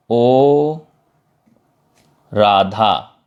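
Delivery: drawn-out "ओ", then "Radha"; calling from afar. Shown as a demonstration. Teacher demonstrates calling Radha who stands far away, drawn-out call. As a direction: hold "ओ" long as if hailing someone distant. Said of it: "दूर खड़ी है तो उसको पुकारना है".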